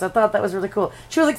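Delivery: normal talking voice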